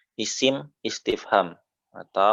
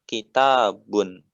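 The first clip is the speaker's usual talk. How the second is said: "kitabun" slowed, the final -un drawn out clearly; dhammah ending; much slower than usual. Shown as a demonstration. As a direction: stretch the -bun of "kitabun" to show the dhammah. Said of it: "Perhatikan harokatnya di situ, dhammah ya".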